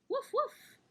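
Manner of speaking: high, loud yappy woof; high, loud puppy woofs; puppy bark. Acted. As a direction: bark it like an excited little puppy. The puppy barks in the story.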